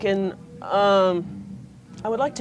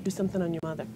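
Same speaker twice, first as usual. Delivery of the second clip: spirit guide whisper; 8 dB quieter than usual. Pretend. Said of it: "and says".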